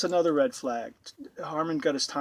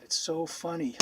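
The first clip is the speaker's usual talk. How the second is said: ordinary talk, nothing marked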